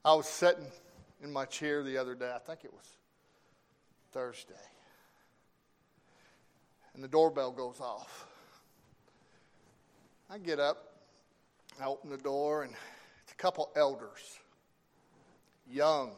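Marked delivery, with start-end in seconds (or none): none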